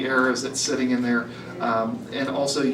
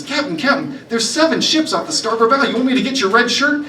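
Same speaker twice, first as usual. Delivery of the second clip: much higher and louder, frantic pirate lad; panicked recruit; 9 semitones higher, 5 dB louder than usual. Acted. Recruit spotting seven ships and panicking to the captain, frantic and loud.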